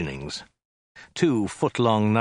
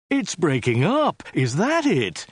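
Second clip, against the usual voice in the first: higher, slow alarmed shout; 7 semitones higher, slower than usual. A narrator's reading. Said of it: "he shouted".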